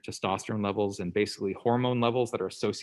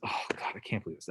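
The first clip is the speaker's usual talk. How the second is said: breathy, grudging mutter under the breath; a mild mock grumble, almost unvoiced; begrudging mutter; 9 dB quieter than usual. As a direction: grumble it like he's put out. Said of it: "sort of begrudgingly".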